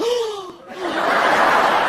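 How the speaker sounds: long high gasp; mock gasp. Out-of-towners hearing about Chico's, mock shocked gasp.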